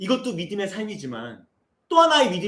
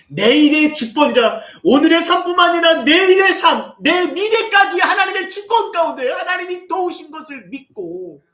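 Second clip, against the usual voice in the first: much higher and louder fervent sermon cry; impassioned preaching; 12 semitones higher, 8 dB louder than usual. Real, not acted. Pastor urging the congregation to trust God, fervent preaching. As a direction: preach it with all your heart.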